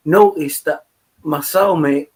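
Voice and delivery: ordinary talk, nothing marked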